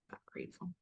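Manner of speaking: a barely voiced whisper of I'm grateful. Real, not acted. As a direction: let the words fade to breath. Repeating thanks, fading away.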